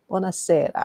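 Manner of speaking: Italian pronunciation of the greeting; Italian accent. Real, not acted. Host greeting a viewer, Italian accent. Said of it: "perfect accent".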